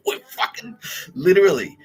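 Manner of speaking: normal talking voice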